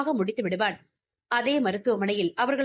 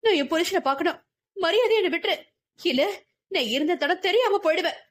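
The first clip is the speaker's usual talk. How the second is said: angry outburst; 8 semitones higher, faster than usual. A narrator's reading. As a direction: explode at him, demanding to be let go. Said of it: "கோபத்தில் வெடித்தவளுக்கு".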